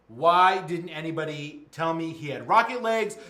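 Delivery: loud acted indignation, rising in pitch; hammed-up exasperated outburst, stressing each complaint; outraged rant. Emperor complains the immersion is broken, outraged.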